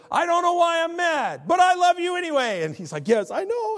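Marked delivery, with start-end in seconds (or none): 0.1-2.6 high, loud mock screaming; imitated girl's scream. Girl screaming at a guy in a video, mock scream. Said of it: "screams at him". 3.1-3.8 very high mock answer; imitated strained reply. Man answering the screaming girl, high-pitched mock reply.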